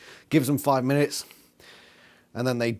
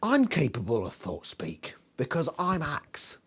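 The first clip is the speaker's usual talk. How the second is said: whispered, much softer inner thought; mock whisper; 7 dB quieter than usual. Imitating Axe thinking to himself, whispered. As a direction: whisper it smugly to yourself.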